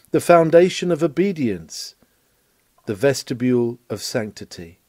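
ordinary talk

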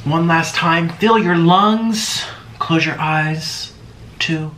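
calm meditation coach voice; guided breathing. Acted. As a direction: lead them gently through each breath. Leads deep breathing.

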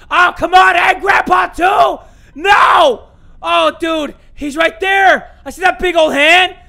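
loud high protesting shout; frustrated yelling. Real, not acted. Caught again by Granny, shouting in frustration. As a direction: yell in disbelief at the game.

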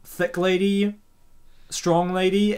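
ordinary talk

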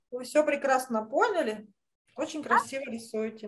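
ordinary delivery

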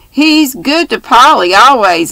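high, loud burst of indignant praise; energetic outburst. A narrator's reading. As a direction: fire it back to defend him. Girl defending Will energetically.

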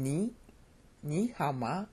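"ni" with a rising Mandarin tone; upper tone. Shown as a demonstration. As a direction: lift the pitch through "ni" in "ni hao ma". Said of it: "that's an upper tone".

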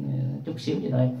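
usual speaking voice

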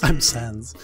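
deep, low character voice; Sans. Acted. Skeleton introducing himself to the player, in a deep voice. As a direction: drop down low for a laid-back introduction. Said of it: "It's always, like, a deep voice".

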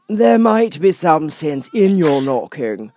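high, slow, lofty footman voice; frog footman. Acted. Frog footman dismissing Alice at the door, lofty. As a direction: talk down to her from on high.

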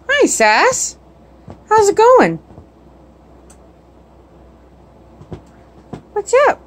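high, sing-song pet voice; squeaky high voice for the dog. Real, not acted. Baby talk to the dog.

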